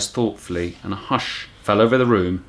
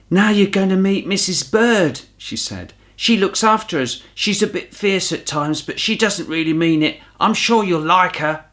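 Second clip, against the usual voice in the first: put-on higher bright girl voice, encouraging; a kind girl reassuring a nervous bear; cheerful girl; 9 semitones higher than usual. Judy tells Paddington about Missus Bird, cheerful. Judy's voice in dialogue.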